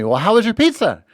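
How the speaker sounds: high-pitched eager question; excited imitation of an eager asker. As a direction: ask it like you can't wait to hear. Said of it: "Sadie was so excited".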